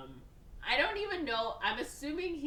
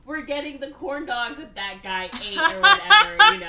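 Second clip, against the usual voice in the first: laughing; 9 semitones higher, 18 dB louder than usual. Retold or imitated. Mock pilgrimage, laughing.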